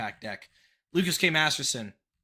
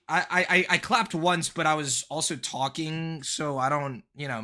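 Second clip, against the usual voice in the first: ordinary talk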